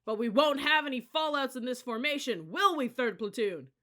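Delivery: high, loud rallying call; loud challenge. A narrator's reading. Cadet leader rousing his platoon, loud challenge. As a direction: fire up the platoon. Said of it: "Then he really let loose".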